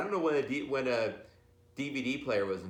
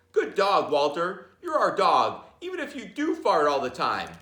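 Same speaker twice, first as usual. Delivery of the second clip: louder, proud praise; praising the dog; 7 semitones higher, 8 dB louder than usual. Acted. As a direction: cheer the dog as a hero. Family praising the hero dog.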